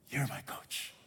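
very soft, low, almost whispered; hushed sincere answer. Retold or imitated. Player answers coach leaning in close, hushed sincere answer. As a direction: confide it quietly.

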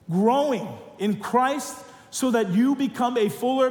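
normal talking voice

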